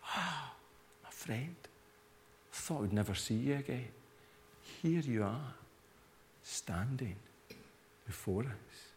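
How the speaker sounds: tender greeting. Retold or imitated. A mourner hugging risen Lazarus, soft and tender. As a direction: greet a lost friend softly. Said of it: "Hugged him".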